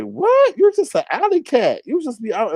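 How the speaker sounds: high-pitched, scandalized telling-off; mock indignation. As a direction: scold her in exaggerated disbelief. Acted scolding of a fallen persona.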